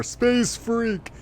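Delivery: high-pitched mock call; imitating game voice. Player mimicking the game's repeated voice lines, mock call. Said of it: "it's not the same voice".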